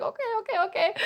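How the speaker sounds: high, soft, hurried backing down; mock placating. Devotee giving in to an offended Anpu, mock placating. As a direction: squeak out a hasty surrender.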